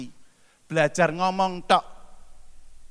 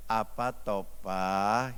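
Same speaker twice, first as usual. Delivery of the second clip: lower, softer, each phrase falling at the end; falling gentle tone; 7 semitones lower, 7 dB quieter, much slower than usual. Shown as a demonstration. Preacher shows wives how to answer a husband with a falling tone. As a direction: say it with the tone going down, gently. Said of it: "harus nadanya ke arah bawah".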